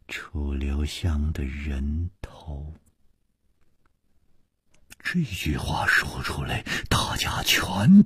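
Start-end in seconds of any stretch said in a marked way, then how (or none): 0.1-2.7 slow hushed whisper, each syllable spaced out; word by word. A narrator's reading. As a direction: breathe it out word by word, chillingly. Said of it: "一字一字地说".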